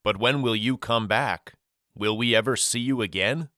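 ordinary delivery, nothing marked

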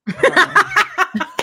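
can't get the words out for laughing. Real, not acted. Host cracking up mid-sentence, laughing.

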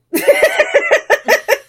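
very high, loud shrieking laugh. Real, not acted. Laughing at "Not you" snub.

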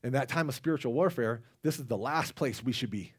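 ordinary talk, nothing marked

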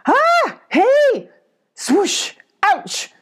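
sudden high screams. Acted. Abby screaming as something swoops at her. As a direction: yelp in shock and pain. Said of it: "Abby scream".

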